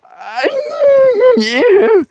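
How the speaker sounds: high strained yes; pained squeak. Retold or imitated. Answering nurse in pain.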